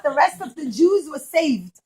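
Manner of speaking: ordinary delivery, nothing marked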